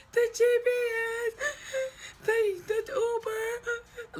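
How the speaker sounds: very high, loud mock wailing; hammed-up meltdown crying. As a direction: sob the words out in gasps, overdone. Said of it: "I'm going fucking through it".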